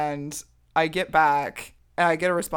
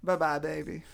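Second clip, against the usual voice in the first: put-on Southern accent; 3 dB quieter than usual. Farewell to followers in a lightly put-on Southern accent. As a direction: sign off to followers, hint of Southern. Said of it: "let's do it in a country accent".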